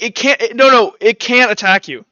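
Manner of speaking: normal talking voice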